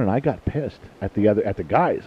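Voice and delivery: usual speaking voice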